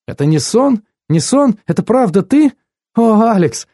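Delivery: high, voiced excitement in character; overjoyed disbelief. A narrator's reading. Woman greeting her surprise lover at the door, overjoyed. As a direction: pitch it far up, as if unable to believe it. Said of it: "смеялась и шептала".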